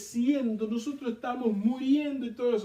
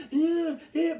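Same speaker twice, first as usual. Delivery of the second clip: high mock newborn wail; short, higher-pitched baby cries; imitated baby's crying; 7 semitones higher, 4 dB louder than usual. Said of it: "que está gritando".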